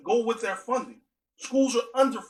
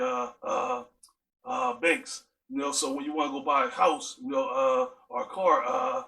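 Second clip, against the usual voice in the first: lower, raspy fatherly advice full of uh fillers; stepdad's deep raspy voice; 5 semitones lower than usual. Retold or imitated. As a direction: play the stepdad, deep and raspy, hesitating before every phrase. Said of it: "Uh, that's how he talks".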